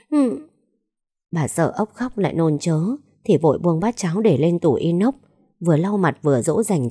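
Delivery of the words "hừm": high whimpering hum; a little tearful hmph; child's whimper. Acted. (tiếng rên mếu máo của trẻ)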